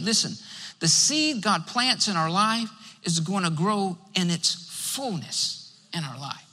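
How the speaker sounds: usual speaking voice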